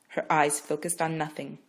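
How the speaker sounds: usual speaking voice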